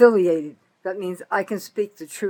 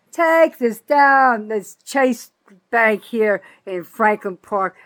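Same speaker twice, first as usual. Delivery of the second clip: loud demand; 3 semitones higher, 5 dB louder, slower than usual. Real, not acted. Ranting woman demands regulators shut down her local bank, loud and slow. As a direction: order it down, word by word.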